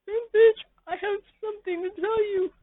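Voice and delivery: very high, wailing whine of the listener; high crying voice. Acted. The listener confessing to Pimpich in a high crying voice. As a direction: squeak it out like a crybaby.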